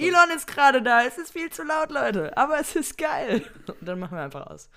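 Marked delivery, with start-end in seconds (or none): 0.0-3.4 shouting over music. Pretend. Mock shouting over club noise.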